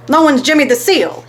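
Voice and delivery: announcing. A narrator's reading. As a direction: call it out to the room. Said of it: "he announced".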